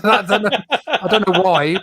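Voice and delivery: laughing. Real, not acted.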